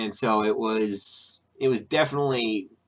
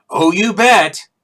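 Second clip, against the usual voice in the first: loud, high, drawn-out mock answer; slow, over-the-top mimicry of an eager reply; mock enthusiasm; 8 semitones higher, 10 dB louder, slower than usual.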